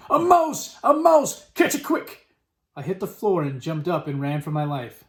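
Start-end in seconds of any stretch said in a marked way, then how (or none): 0.1-2.3 very high, urgent cries; shouting. A narrator's reading. Kitchen staff raise the alarm over a mouse, shouting. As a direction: shout the alarm to everyone, pitched right up. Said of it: "they were shouting".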